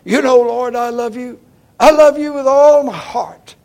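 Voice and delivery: loud, high, exasperated protest; boiling over. Acted. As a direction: insist at the top of your voice, boiling. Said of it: "began to boil this a little bit".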